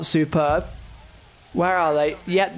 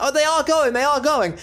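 much higher, thrilled running commentary; can't believe they're actually going; delighted squeal of progress; excited; 8 semitones higher than usual. Real, not acted. Watches his troops head out.

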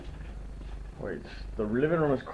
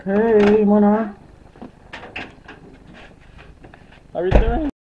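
high dog-greeting voice; 11 semitones higher, 8 dB louder than usual. Real, not acted. Greeting her dog Mona in high baby talk.

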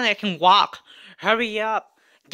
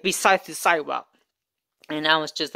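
ordinary talk, nothing marked

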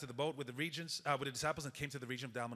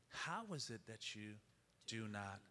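soft pleading question; 5 semitones lower, 11 dB quieter than usual. Acted. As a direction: let it sink in quietly. Jesus' question repeated very softly.

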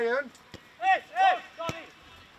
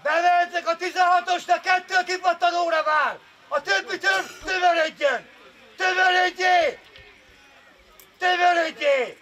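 shouted coaching. Real, not acted. Coach organises players for a rebound, shouting. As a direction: bellow instructions across the pitch.

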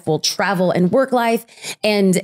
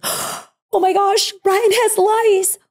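much higher, slow, panicked cry; panicked shriek; 12 semitones higher, much slower than usual. Retold or imitated. Mother discovering lice, panicked to her husband. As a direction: shriek it in horror. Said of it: "I was like".

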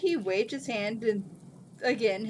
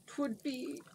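softer hesitant start; faltering; 5 semitones higher, 8 dB quieter than usual. A narrator's reading. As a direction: lose the word mid-sentence. Jin falters.

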